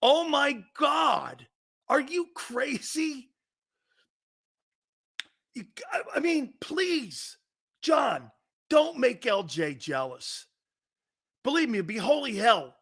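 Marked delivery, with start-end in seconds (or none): 0.0-7.4 much higher, slow and hammed-up; mock panic. Real, not acted. Mock panic about jealous girlfriend. 7.9-10.5 slow plea. Real, not acted. Pleading with John.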